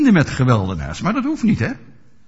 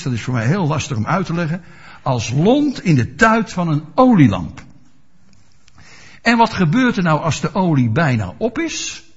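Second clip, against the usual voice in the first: ordinary talk, nothing marked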